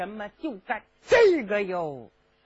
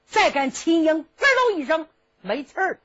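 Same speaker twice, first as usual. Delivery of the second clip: ordinary delivery, nothing marked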